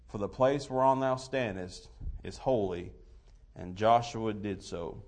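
scripture reading. Real, not acted. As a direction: read the King James verses with weight. Reads King James scripture aloud.

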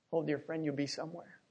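normal talking voice

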